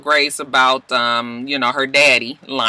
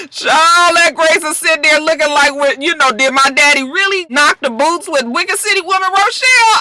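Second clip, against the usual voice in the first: high, loud scandalized retelling; mock disbelief; 12 semitones higher, 4 dB louder than usual. Daughter wonders about daddy and Rochelle, hammed-up disbelief. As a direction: push it up high like hot gossip. Acting out Grace's disbelief at the news.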